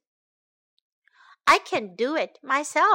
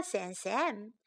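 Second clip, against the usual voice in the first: plain reading voice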